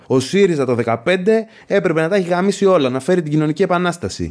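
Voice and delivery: smug mock shout. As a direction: proclaim it like a know-it-all shouting slogans. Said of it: "Και φωνάζω και λέω".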